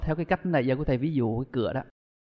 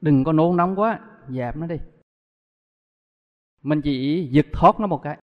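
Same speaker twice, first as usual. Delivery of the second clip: ordinary delivery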